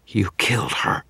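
lightly voiced vampire woman, a hint of a snarl; mild snarl. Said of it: "the vampire snarled".

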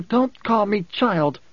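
high, slow, emphatic snap; snapped rebuke. A narrator's reading. Woman rebuking the Mentat for calling her child, snapping. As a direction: snap it word by word. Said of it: "she snapped".

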